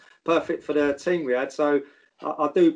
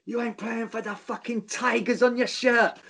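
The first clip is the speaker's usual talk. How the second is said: higher, slow mock rant of an angry manager; mock half-time rant; 8 semitones higher than usual. Manager ranting at players at half time, higher and slow. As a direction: ham up the furious old manager. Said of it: "And he'd say".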